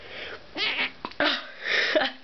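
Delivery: muffled held-in sneeze; stifled sneeze. Real, not acted. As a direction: squeeze the sneeze back through closed lips. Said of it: "That one was really nasty".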